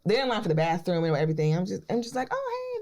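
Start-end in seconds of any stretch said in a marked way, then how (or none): 2.3-2.8 tiny high-pitched hello; imitated starstruck squeal; awkward greeting. Acted awkward starstruck small talk.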